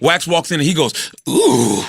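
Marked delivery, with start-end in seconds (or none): 1.3-1.8 low campy "ooh", lightly put on; old flamer. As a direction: coo it like an old flamboyant man. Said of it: "my guy is a old flamer".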